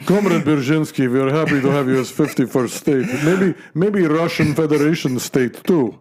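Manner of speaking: mock Russian official voice; hammy comrade accent.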